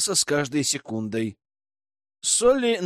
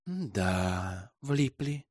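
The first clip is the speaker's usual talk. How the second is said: lower, much softer flat verdict; detached; 6 semitones lower, 9 dB quieter, much slower than usual. A narrator's reading. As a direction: state it absently, far away. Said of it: "отрешённо констатировала она".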